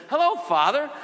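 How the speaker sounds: high, loud friendly hello; patient greeting. Retold or imitated. Hospital patient greeting the visiting priest, high and loud. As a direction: greet the priest brightly from the bed. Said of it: "he says".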